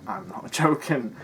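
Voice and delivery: slow, chuckling denial; amused protest; laughing through it. Real, not acted. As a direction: laugh mid-line while insisting. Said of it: "I can't tell whether he's joking".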